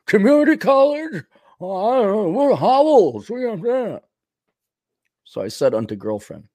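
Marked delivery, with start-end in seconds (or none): 0.1-4.0 high, swooping snob voice; Winston Howell. Acted. Rich castaway scorning community college, swooping snobbish voice. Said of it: "anyone can do Winston Howell".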